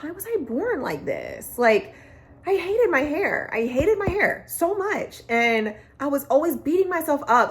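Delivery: holding back tears while speaking; real emotion, close to tears. Real, not acted. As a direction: voice rising high and strained on hated. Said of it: "I am kind of struggling".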